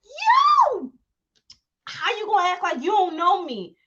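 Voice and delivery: laughing mock outrage; mock outraged yell. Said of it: "I was like".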